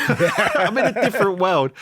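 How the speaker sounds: amused disbelief at his new world; laughing repeat. Real, not acted. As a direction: savour the line with a laugh. The same line repeated, laughing.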